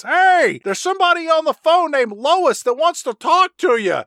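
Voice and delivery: high, loud call over rotor noise; shouting over helicopter. Retold or imitated. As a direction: yell it over the engine. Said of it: "the pilot says".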